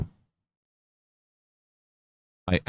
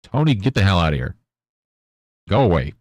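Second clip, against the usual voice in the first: louder, fast shoo-away; shooing the cat; 4 semitones higher, 5 dB louder, faster than usual. Real, not acted. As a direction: chase the cat off the desk.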